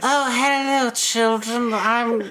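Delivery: high, airy, flirty lady voice; imitating a breathy glamorous mother. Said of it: "such an amazing, like, airy voice".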